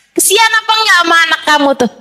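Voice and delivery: normal talking voice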